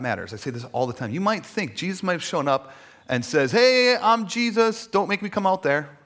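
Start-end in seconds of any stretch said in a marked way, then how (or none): 3.5-6.0 loud, high, drawn-out announcement; hammed-up warning shout; mock bossy call. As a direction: call out like a self-important boss. Mock bossy Jesus calling out.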